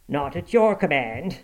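mildly defiant comeback; a touch of defiance; retort. A narrator's reading. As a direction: answer back curtly. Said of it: "retorted Hareton".

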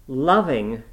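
loud, scornful outburst. A narrator's reading. Nurse mocks the girl's talk of love, scornfully. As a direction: throw the word back with contempt. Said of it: "as scornfully as I could utter the word".

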